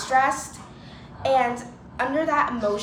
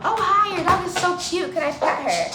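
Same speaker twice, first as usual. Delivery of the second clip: high-pitched dog-lover greeting; gushing stranger; 7 semitones higher than usual. Retold or imitated. Stranger asking to pet her dog, gushing. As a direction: coo over a cute dog, high at the start.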